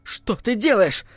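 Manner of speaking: alarmed cry. A narrator's reading. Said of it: "закричала Аманда".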